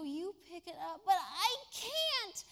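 lightly voiced small boy, stretched out; a hint of a whiny child. Preacher voicing a little boy refusing.

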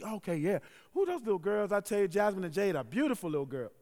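loud, lightly imitated old lady. Old neighbor praising the pastor's little girls, old lady voice. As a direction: gush like an elderly neighbor. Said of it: "so it's my old lady voice".

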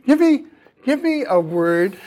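normal talking voice

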